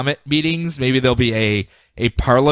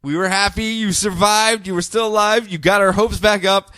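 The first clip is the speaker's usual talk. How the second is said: mock mourning; 7 semitones higher than usual. Mock eulogy for Muck Muck.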